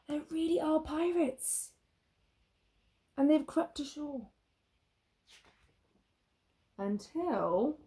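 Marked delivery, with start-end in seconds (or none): 0.1-4.3 excited realisation. A narrator's reading.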